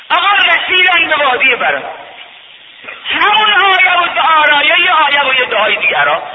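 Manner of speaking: high, loud chanted preaching; soaring rowzeh-style sermon chant; preaching at full force. Acted. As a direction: lift the journey into a ringing chant.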